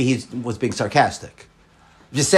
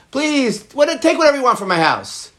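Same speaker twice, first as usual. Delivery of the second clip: sarcastic offer; 10 semitones higher than usual. Pretend. Sarcastic offer of whole house.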